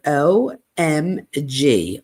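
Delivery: O, M, G spelled out slowly, each letter apart; letters said separately. Retold or imitated. Reading her serious text, letters spaced slowly. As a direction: read "O M G" as three slow separate letters. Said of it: "all separated".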